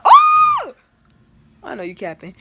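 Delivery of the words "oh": very high, loud drawn-out "oh"; high mock-shocked shout; shocked cry. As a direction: yelp in scandalized shock. Reenacting shock at thrown shade.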